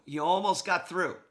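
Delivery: eager excited call. Real, not acted. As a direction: get excited that he nearly made it. Coach reacting to the replay.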